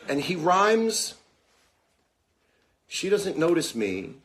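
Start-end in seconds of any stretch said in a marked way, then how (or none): 0.1-1.2 awed excitement. Real, not acted. Amazed build-up about the rhyme.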